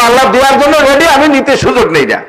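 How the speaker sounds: mock prayer to Allah. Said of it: "আল্লাহর কাছে বললাম".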